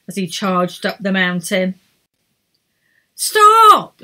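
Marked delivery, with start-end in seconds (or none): 3.2-4.0 high, loud shout of stop; commanding shout. A narrator's reading. Edith stopping Errol in the storm, loud shout. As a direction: bark it to halt everyone. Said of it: "said Edith".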